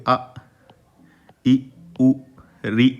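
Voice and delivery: आ, इ, उ, री each said short and crisp, one by one; short vowel demo. Shown as a demonstration. Teacher showing the class short Hindi vowels, clipped and separate. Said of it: "जैसे ह्रस्व स्वर में आ बोलोगे".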